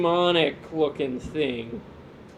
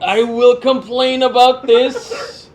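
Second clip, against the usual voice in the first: lightly voiced cranky old man; 4 semitones higher, 7 dB louder than usual. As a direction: grumble like a cranky grandpa. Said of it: "Old man [name]'s yelling".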